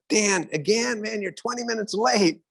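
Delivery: high, exasperated complaint; annoyed friend. Retold or imitated. The one kept waiting scolds his late friend, exasperated. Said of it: "And you go".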